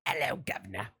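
low put-on cab-driver voice; British catchphrase. Raccoon announces the horror film title, low mock-British voice.

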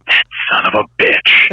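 slow, voiceless deadpan curse; mock-serious punchline. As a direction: act out a grave father, under the breath. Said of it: "gets a serious look on his face".

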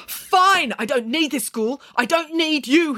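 high-pitched furious outburst; angry scream. Acted. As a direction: scream defiance at the headteacher. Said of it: "he screamed at Doctor Belcrady".

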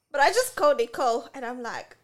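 usual speaking voice

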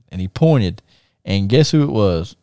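ordinary delivery

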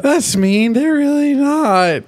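high, slow, eerie sing-song denial; cracked cheerful voice, drawn out high; broken Pinkie Pie. Retold or imitated. Recapping the episode, voicing a snapped Pinkie Pie. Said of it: "And her eyes go sideways, like".

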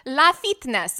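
"La Fitness" with LA as one syllable, loud; mother's mispronunciation. Retold or imitated. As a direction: read the letters as the word "la" in "La Fitness". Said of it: "that was like my mom with L A Fitness".

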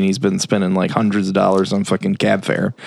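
normal talking voice

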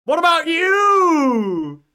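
long, high, drawn-out shout; playful holler. Real, not acted. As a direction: throw it back in a stretched-out yell. Playfully throws question back.

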